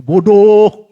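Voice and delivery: a sudden loud bodoh; self-scolding shout. Retold or imitated. Preacher scolding himself aloud after the fight. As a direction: bark it at yourself.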